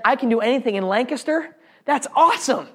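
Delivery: excited reply. Retold or imitated. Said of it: "I responded, I said".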